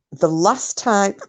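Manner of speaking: hiccuping mid-phrase; words broken by a hiccup. Real, not acted.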